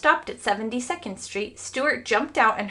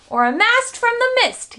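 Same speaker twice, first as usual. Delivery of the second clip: much higher, loud yell; 13 semitones higher, 7 dB louder than usual. A narrator's reading. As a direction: shout to win the contest. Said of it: "yelled the man".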